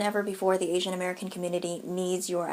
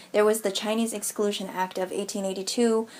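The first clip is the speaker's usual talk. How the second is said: ordinary talk, nothing marked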